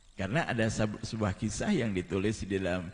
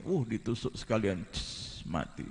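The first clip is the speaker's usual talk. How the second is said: 1 mock stab; 4 dB quieter than usual. Acting out the stabbing.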